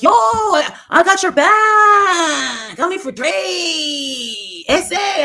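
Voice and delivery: high, drawn-out mock holler; mocking yell. She imitates a woman backing Dre, mocking yell. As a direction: stretch it out in a loud, high mimicking shout. Mimics woman yelling on a live.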